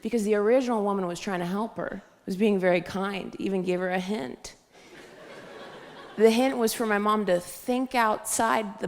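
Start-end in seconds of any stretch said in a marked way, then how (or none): none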